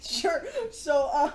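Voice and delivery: tearful laughter; teary laughter; laughing to tears. Real, not acted. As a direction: speak through laughing tears. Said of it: "laughs cry".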